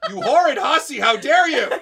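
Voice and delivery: loud, high melodramatic fury, laughing; mock outrage. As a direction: ham up an offended soap-opera diva.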